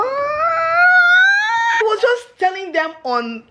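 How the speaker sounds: high, slow, crying shout; tearful excitement. Real, not acted. Wife opening her husband's gift, tearful and excited. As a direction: voice cracking with emotion.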